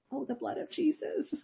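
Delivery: high, soft squeaky singing; squeaky little voice. Retold or imitated. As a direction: squeak it out timidly, barely voiced. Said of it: "singing in a squeaky little voice".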